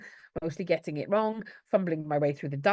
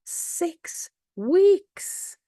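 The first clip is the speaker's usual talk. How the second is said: high rising disbelief; incredulous; 11 semitones higher, 4 dB louder than usual. Real, not acted. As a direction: sound amazed at the length. Reacting to the school holiday length.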